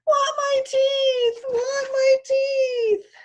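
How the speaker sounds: put-on high, loud, very slow wailing toddler; a toddler howling for his lost teeth; screaming toddler. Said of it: "Fudge started to scream".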